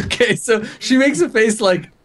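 high, real laughter through the words; can barely get it out for laughing. Real, not acted. Game master cracking up while describing the clerk, real laughter.

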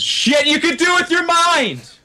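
shouting in a high voice about mind powers; outraged shout. Acted. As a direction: bellow it out in disgust. Character yells in outburst.

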